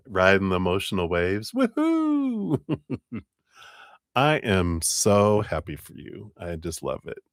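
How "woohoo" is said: high joyful cheer. Real, not acted. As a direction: whoop for them. Celebrating caller's news.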